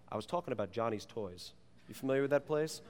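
ordinary delivery

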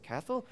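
high questioning "Castle" with th for s; "Castle" in an imitated little boy's big lisp; lisping toddler. Preacher imitating his son's "Castle", lisping. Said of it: "Big lisp".

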